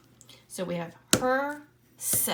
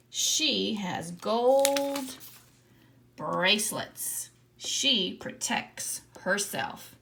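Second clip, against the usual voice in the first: slow, louder word by word reading; slow read-aloud; 3 semitones higher, 5 dB louder, much slower than usual. Shown as a demonstration. Teacher reading the whole Wonder Woman text aloud to the class. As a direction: read it out slowly, every word clear. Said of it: "let's read what we've written".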